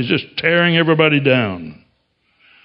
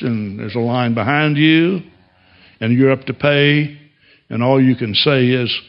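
ordinary delivery

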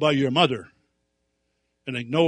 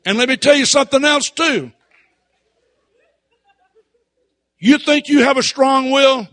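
building stern shout; 4 semitones higher, 10 dB louder than usual. Retold or imitated. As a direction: voice climbing from firm to full shout. Preacher retells confronting defiant boy.

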